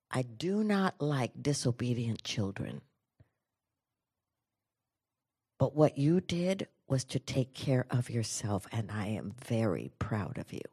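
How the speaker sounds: soft, low, measured pride; quietly sincere. Retold or imitated. Mother to daughter at the classroom door, quietly sincere.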